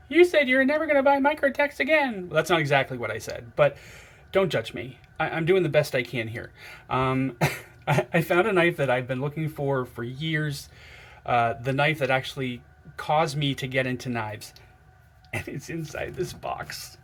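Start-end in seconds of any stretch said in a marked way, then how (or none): 0.1-2.3 high, loud teasing quote of a viewer; mock viewer voice. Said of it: "I know what you're thinking". 15.3-17.0 slow, hushed whisper. Real, not acted. Secret reveal of the box.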